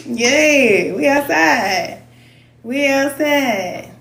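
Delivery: slow, drawn-out we outside; hyped catchphrase. Real, not acted. Excited about summer, slowly hypes we outside. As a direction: drawl it like a summer hype line.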